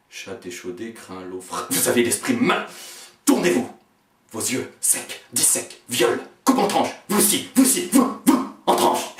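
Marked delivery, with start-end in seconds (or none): 1.7-9.1 higher and louder, climbing to a shriek; shrieking tirade. Acted. Raging at everyone, a climbing shriek. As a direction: lash out, voice rising wildly.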